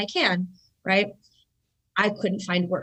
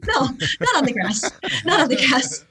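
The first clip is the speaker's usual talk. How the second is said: louder, laughing exasperation; pitched-up, emphatic mock impatience; exasperated correction; 4 semitones higher, 4 dB louder than usual. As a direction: correct mom in comic disbelief. Correcting confused mom, acted out.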